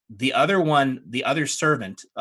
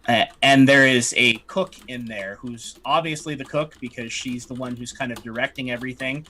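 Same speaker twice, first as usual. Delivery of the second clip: ordinary talk, nothing marked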